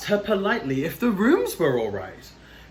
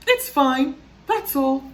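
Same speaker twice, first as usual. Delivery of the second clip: curt high ant voice; 9 semitones higher, 3 dB louder than usual. Acted. Miss Ant answers curtly.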